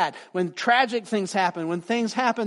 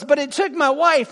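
excited; 9 semitones higher, 8 dB louder than usual. Retold or imitated. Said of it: "all excited".

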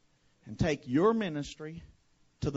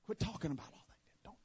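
hushed mock-secret whisper; 3 semitones higher, 8 dB quieter than usual. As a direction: whisper it like a sly insider tip. Whispered mock advice aside.

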